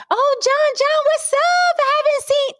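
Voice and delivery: very high-pitched gushing greeting; other woman's voice. Retold or imitated. Woman running up to John at the airport, squealed greeting. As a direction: squeal it like a delighted acquaintance. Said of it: "I heard a woman's voice coming from behind".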